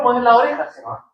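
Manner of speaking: usual speaking voice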